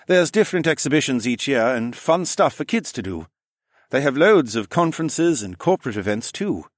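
high-voiced man giving a tour; French-accented engineer. A narrator's reading. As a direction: explain the place as the nervous engineer.

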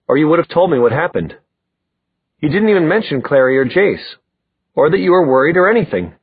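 mildly upset, hurried complaint; accusing. Acted. Accusation in quarrel.

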